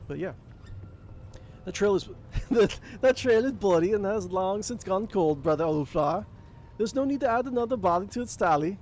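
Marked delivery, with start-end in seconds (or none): 1.6-8.8 put-on a warrior NPC in a half-laughing accent; odd put-on accent. Game master voicing the NPC, giggly accent. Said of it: "What is this accent I'm doing?".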